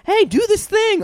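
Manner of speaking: squeaky imitation of a pushy fan; high, silly voice asking for a trick; high mock request voice. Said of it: "I just live for people to be like".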